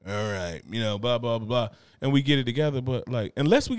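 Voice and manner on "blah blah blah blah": ordinary delivery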